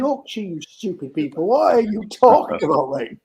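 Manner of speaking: high, loud, indignant protest; joking indignation, rising in volume; mock outrage. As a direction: play offended at being mistaken for Welsh. Mock outrage at being called Welsh.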